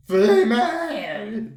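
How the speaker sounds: loud, drawn-out shout; excited yell. Acted. Artist finding out his new contact is female, loud excited yell. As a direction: yell it in stunned excitement, stretched long.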